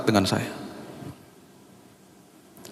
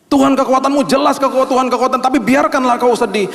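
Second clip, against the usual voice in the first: preaching at full force; 12 semitones higher than usual. Acted. Preacher warns the church against wrong words, fervent. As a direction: drive the warning home to the congregation.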